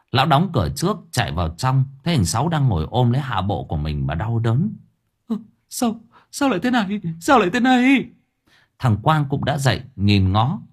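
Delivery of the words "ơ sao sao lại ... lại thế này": very high-pitched whine, building in volume; panicked alarm. A narrator's reading. Man discovering his injury in pain, panicked. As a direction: cry out in rising panic. Character alarmed at swollen groin.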